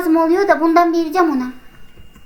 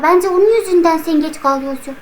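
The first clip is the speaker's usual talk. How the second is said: ordinary talk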